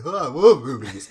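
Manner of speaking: loud, drawn-out dad impression; hammed-up delight, slow and booming with a laugh; imitated enthusiastic dad. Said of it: "Exactly in that, in that voice".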